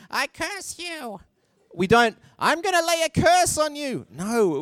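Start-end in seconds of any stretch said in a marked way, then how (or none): 0.1-1.2 high, slow witchy curse; wicked witch. Pretend. Preacher mocking a storybook witch cursing, high and slow. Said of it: "like a wicked witch". 2.4-4.1 high, theatrical witch voice; hammed-up villain curse; witchy curse. Preacher parodying an old-fashioned curse, high and theatrical. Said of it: "we don't actually talk like that".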